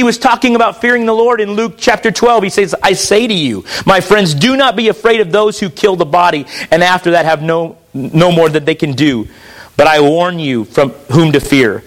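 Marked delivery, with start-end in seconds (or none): 2.8-11.8 solemn reading, sinking very low; scripture reading. Acted. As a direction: read it as a grave warning, slowing on key phrases. Reads scripture aloud.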